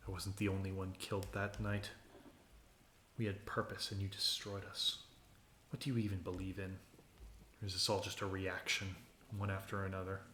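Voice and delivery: low, hushed voice of the slain; accusing spirit of the dead. Acted. A slain spirit confronts the one who destroyed them, low hushed reproach.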